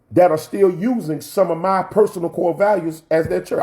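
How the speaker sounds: high, ringing preacher's voice; rising into full sermon delivery; preaching. Acted. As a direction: preach it out to the congregation.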